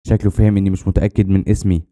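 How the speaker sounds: ordinary delivery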